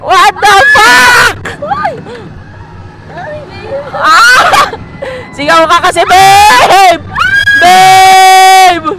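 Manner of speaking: whoops and long yelled words; full-voiced thrill-ride shouting; real ride screaming. Real, not acted. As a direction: yell to your partner over the ride.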